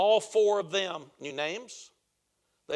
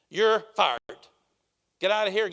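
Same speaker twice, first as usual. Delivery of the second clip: louder, curt boss's dismissal; a retold owner throwing a man out; hammed-up boss snapping you're fired; mock angry firing; 7 dB louder than usual. Owner firing general manager.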